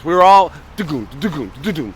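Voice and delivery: vocalising the opening riff, da-goon da-goon; imitated guitar riff. Guest mimics the guitar riff for the host, voicing it as da-goon. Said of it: "with that guitar and that drum".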